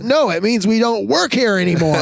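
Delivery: put-on high child voice, earnest and sure; a small boy setting his brother straight; little kid explaining. Dad imitating his five-year-old explaining the firing.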